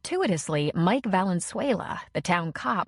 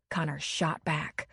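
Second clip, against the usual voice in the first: ordinary talk, nothing marked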